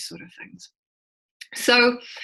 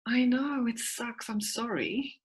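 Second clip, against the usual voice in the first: soft, much quieter gentle sympathy; authentic validation; 4 semitones higher, 7 dB quieter than usual. Retold or imitated. Mum validating her kids, soft and sincere. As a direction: say it gently, really meaning it. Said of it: "When I remember and I'm validating".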